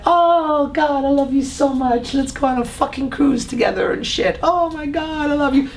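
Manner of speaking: put-on high, gushing lovestruck girlfriend; mock-adoring girlfriend voice, pitched high. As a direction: swoon over him, way up high. Acted woman gushing at man.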